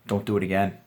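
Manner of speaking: low, soft fake-serious warning; deadpan scolding as a joke; mock stern. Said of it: "serious look on my face".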